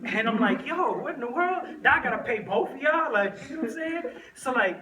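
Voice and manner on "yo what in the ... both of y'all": high-pitched comic disbelief; incredulous dismay. Retold or imitated. Retelling his shock at two helpers to pay, high mock dismay. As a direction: balk at a doubled bill, pitch jumping up.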